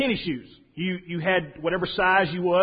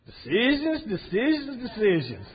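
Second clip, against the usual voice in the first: high, drawn-out mock fatherly voice, falling at the end; dad's teasing refrain; 4 semitones higher than usual. Dad to his stressed son, high teasing singsong. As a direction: imitate a dad needling his stressed kid.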